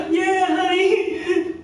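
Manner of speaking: very high, slow, drawn-out mock reply; hammed-up half-asleep wife answering; husband's voicing of his drowsy wife; sleepy wife. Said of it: "She's waking up".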